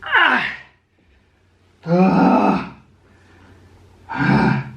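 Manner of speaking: sharp loud grunts of strain; effort grunts. Real, not acted. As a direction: grunt hard on each push-up. Grunting through press-ups.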